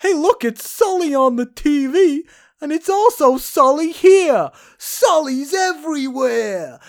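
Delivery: high, loud, hammed-up New York guy; a brash New York accent, high and loud; aggressive New Yorker. Bar guys spotting Sully on TV, in a hammed-up New York accent. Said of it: "two other guys who were just sat there go".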